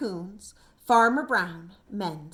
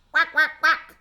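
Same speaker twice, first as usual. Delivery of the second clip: duck quacking; 3 semitones higher, 6 dB louder than usual. A narrator's reading. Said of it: "Little Duck greeted each guest".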